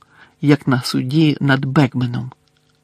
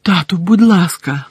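begging; 5 semitones higher, 6 dB louder than usual. Acted. Son begs his father.

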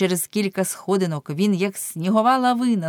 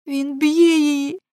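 wailing; 8 semitones higher, 4 dB louder than usual. A narrator's reading. Girl wailing as her mother is beaten. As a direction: wail out in guilty despair. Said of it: "заголосила Неле".

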